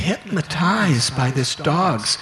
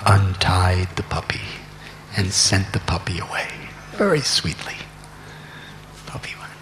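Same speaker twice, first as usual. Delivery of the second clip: soft, low whisper; hushed whisper; 10 semitones lower, 7 dB quieter than usual. A narrator's reading. Narrating the mother's secret deed, in a low whisper. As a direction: tell it like a secret behind his back.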